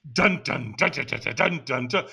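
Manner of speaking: rhythmic vocal drum imitation; imitated drumbeat. Imitating African drummers at the firewalk, drumming sounds. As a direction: beat it out like hand drums. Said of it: "he's got African drummers".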